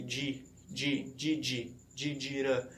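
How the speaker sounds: "di", "di, di", then "dira", softly separated; step-by-step syllable drill. Shown as a demonstration. Learner showing how he began training the word, syllable by syllable. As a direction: build the word from "di" to "dira", piece by piece. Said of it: "Então eu fui passo a passo".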